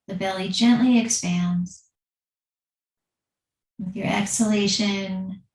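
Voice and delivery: calm, unhurried yoga teacher voice with long pauses; guided meditation. Acted. Leads seated breathing meditation.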